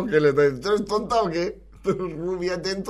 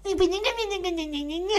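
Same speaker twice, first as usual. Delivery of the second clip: squeaky mock laugh; 4 dB quieter than usual. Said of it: "hacer esto una vez al día".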